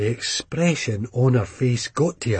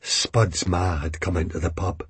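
plain reading voice

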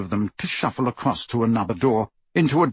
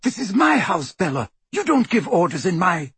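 high, indignant objection; indignant protest; 7 semitones higher than usual. A narrator's reading. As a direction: protest until you are cut off. Narcissa protests at sister's orders.

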